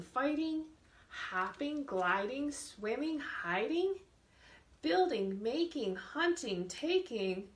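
slow, lilting storybook read for little ones; reading to children. Acted. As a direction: read it slowly to a class of small kids. Teacher reads picture book aloud.

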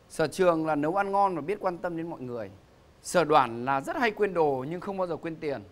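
booming announcer introducing contestants; game show host. Acted. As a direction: present them to the studio audience.

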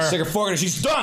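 high, excited calling out. Real, not acted. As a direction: yell it high and gleeful.

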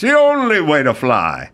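smooth slogan, lightly announcer-styled; airline ad voice. Retold or imitated. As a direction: sell the slogan like an old ad. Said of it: "And they'd go".

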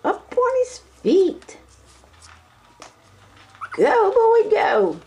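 root for the pup like a proud fan. Real, not acted. Owner cheering puppy up on his feet, high excited voice.

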